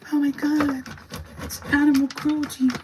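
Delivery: very high, shrill-pitched mock outrage; mocking Karen voice. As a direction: parody a horrified viewer, way up high. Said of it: "and be like".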